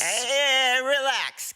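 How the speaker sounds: high, breezy brush-off; mock reassuring. Rocket brushing off worried Gamora, high breezy dismissal. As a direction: wave off the worry in a high voice.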